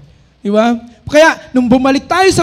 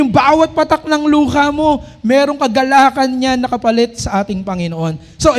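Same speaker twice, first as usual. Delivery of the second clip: usual speaking voice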